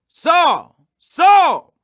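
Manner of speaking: a loud, booming call from above; a divine voice calling out, not quite a shout; calling from heaven. Acted. Voice from heaven calls Saul.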